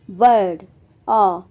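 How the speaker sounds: बर्ड said loud with a long er; er vowel demo. Shown as a demonstration. Teacher models the bird vowel for students. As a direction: stretch the er in बर्ड. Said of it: "इसके बाद है".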